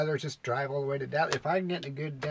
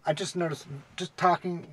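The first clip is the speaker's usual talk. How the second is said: ordinary delivery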